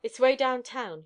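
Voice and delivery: narrator's brusque instruction; short, curt. A narrator's reading. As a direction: state it shortly. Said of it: "he said shortly".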